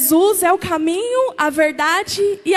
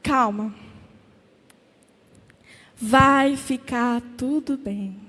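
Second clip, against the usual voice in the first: much lower, soft and slow reassurance; God's calm voice; 4 semitones lower, 3 dB quieter, slower than usual. Acted. Preacher voicing God calming a weary believer, low and gentle. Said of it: "e Deus fala assim".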